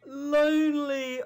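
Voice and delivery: high, slow drawn-out lonely; mock plaintive Lonely. Shown as a demonstration. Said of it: "If I say lonely".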